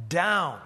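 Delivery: "down" set apart and hit hard; emphasis on "down". Shown as a demonstration. Preacher stressing key words of the Jonah text, emphatic "down". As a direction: land "down" firmly after a pause. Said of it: "I'll emphasize the key words".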